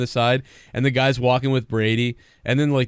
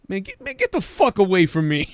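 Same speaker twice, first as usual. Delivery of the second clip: mock-irritated brush-off, pitched up; annoyed player; 7 semitones higher than usual. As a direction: play a player fed up with a pest. Acted player brushing off the trainer.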